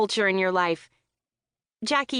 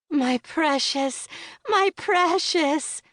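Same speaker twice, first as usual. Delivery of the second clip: slow, much higher creepy "my precious"; Gollum impression; 8 semitones higher, much slower than usual. Friend ending the hobbit joke, slow high Gollum croon. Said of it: "Jackie finishes with a flourish".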